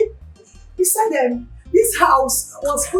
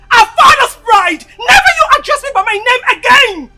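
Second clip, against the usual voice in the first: loud, raised, offended command; imperious yelling; 4 semitones higher, 8 dB louder than usual. Acted. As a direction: pull rank at full volume. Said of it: "[name], you are yelling".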